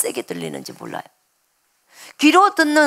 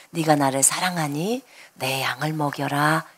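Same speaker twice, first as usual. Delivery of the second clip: soft low voice; 8 semitones lower, 5 dB quieter than usual. Retold or imitated. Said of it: "이렇게 말씀하셔요".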